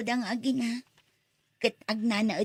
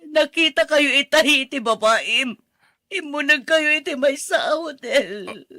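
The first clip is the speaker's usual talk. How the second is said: 목소리를 높여 따지는 분노; 바람피운 남편을 큰 소리로 몰아세우는 아내; angry accusation; 3 semitones higher, 9 dB louder than usual. Acted. Wife shouts accusations.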